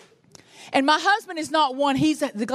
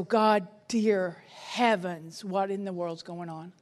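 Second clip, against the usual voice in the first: very slow, much softer, lower plea to God; despairing prayer; 6 semitones lower, 5 dB quieter, slower than usual. Retold or imitated. Alone with her Bible, a very slow, soft, despairing plea. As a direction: sink into a helpless whisper of a prayer. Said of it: "I just go".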